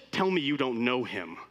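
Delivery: ordinary delivery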